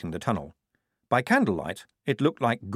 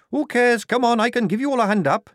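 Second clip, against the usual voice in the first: much higher, louder brisk voice; brisk Tonka; 14 semitones higher, 6 dB louder than usual. A narrator's reading. Tonka from above offering the squad a hand, high and brisk. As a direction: wave the others up impatiently.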